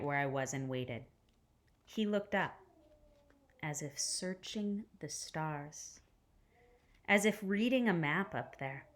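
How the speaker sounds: gentle read-aloud storytelling voice; hushed, careful picture-book reading; story narration. A narrator's reading.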